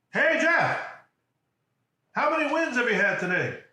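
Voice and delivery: loud, high-pitched holler; coach's booming yell. Retold or imitated. Coach to paralyzed player in intensive care, high loud yell. Coach's shout acted in a story.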